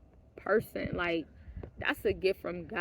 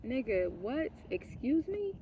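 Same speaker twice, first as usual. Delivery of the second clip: retold disbelief; 3 semitones higher, 4 dB quieter, slower than usual. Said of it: "I was like".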